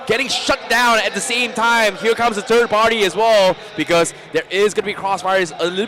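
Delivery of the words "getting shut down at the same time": hyped caster yelling over the fight; excited shout. Real, not acted. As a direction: yell it like the team just got wiped. Caster hypes team wipe.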